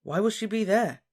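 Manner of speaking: high-pitched wondering query; eager question. A narrator's reading. Lovesick Ron questions Harry, eager.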